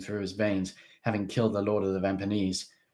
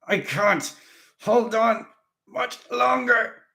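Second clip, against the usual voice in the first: high strained moan, slow at the end; 14 semitones higher, 7 dB louder, slower than usual. A narrator's reading. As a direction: groan it under the strain. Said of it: "he moaned".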